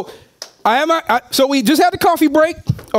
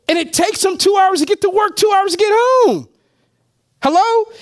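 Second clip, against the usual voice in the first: indignant shout; 6 semitones higher than usual. Real, not acted. Outraged point about long commutes.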